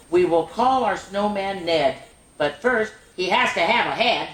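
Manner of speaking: sing-song rhyming read-aloud; bouncy picture-book reading; reading to children. Acted. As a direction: lilt each line for little listeners. Reads rhyming snowman book aloud.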